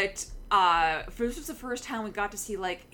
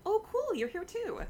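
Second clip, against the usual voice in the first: quoting a blasé crowd, pitched much higher; hammed-up nonchalant quip, much higher; mock blasé crowd; 12 semitones higher than usual. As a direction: play it unimpressed, as if Jesus were just another guest. Mock blasé crowd reply to Jesus.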